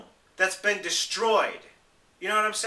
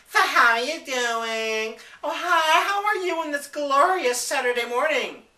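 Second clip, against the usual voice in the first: mock cheerful greeting; 8 semitones higher, 4 dB louder than usual. Mocking the customers who kept asking how he was, in a high, chirpy mock voice. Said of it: "all day long I kept hearing from everybody was this".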